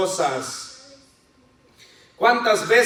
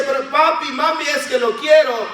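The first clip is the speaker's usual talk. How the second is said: put-on whiny child; 6 semitones higher than usual. Sick child begging for ice cream.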